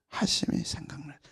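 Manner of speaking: soft unvoiced remembering; whisper. Real, not acted. Preacher whispering Peter's sudden memory of Jesus' word. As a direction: breathe it as a memory.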